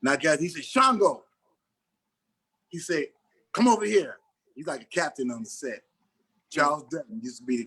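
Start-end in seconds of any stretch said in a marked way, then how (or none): 0.6-1.2 called out across the set, louder and higher; a director calling a name across the set. Retold or imitated. Director calling him over on set. 3.5-4.2 raised, higher call across the set; a director calls the new actor over. Retold or imitated. Director calling him over.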